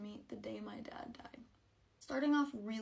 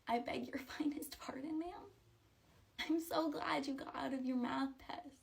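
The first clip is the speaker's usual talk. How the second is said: mock-polite, breathy voiceless sarcasm; sarcastic whisper; 4 semitones higher than usual. Real, not acted. Grieving girl mocking her friend's comment, sarcastic whisper. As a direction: whisper it with icy fake courtesy.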